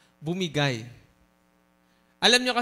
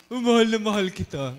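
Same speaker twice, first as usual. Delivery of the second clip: louder, swooning lovesick voice; mock lovesick texter; 2 semitones higher, 6 dB louder than usual. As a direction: ham up a lovesick teen. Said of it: "Sino yung ganyan?".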